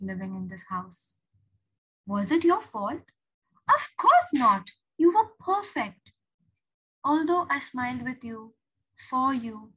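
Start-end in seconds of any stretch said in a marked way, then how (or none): 3.7-6.1 "Of course not" slow, high and loud; emphatic reassurance. Acted. April reassuring Julia by letter, "Of course not" drawn out emphatically. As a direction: lift "perfect" high with emphatic sincerity.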